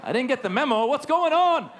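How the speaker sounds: high, alarmed, confused; panicked inner voice. Retold or imitated. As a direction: squeal it out like a suspect who missed the memo.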